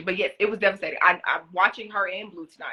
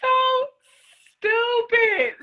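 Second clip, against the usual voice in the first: self-scolding; 6 dB louder, much slower than usual. Real, not acted. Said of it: "I was stupid".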